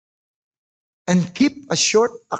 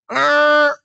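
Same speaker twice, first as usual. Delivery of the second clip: tantrum growl; 6 semitones higher, 4 dB louder than usual. Retold or imitated. Child's angry growl imitated.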